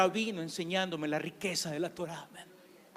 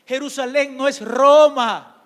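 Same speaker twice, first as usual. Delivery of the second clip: loud, slow, forceful declaration; emphatic shout; 4 semitones higher, 13 dB louder, slower than usual. Acted. Preacher insisting to the congregation, loud slow emphatic declaration. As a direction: hammer the point home, loud and slow.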